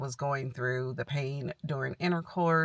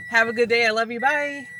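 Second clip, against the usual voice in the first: higher, louder singsong goodbye; 7 semitones higher, 5 dB louder, faster than usual. Real, not acted. Calls goodbye to her child.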